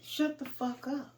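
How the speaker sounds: high-pitched retold yell, little added volume; mild imitation of a defiant yell. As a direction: throw it back as a defiant retort. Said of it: "He yelled out".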